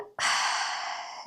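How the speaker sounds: breathy release sigh; long sigh. Shown as a demonstration. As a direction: let it all out in one long sigh. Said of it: "let go with your mouth open".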